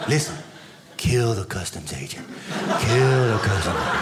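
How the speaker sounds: menacing little voices plotting; sinister toy voice. Retold or imitated. Toys' voice urging murder.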